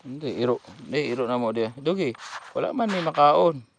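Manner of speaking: high, loud calls to shoo the dog. Real, not acted. Vlogger shooing a hungry dog away from the gear, high loud calls.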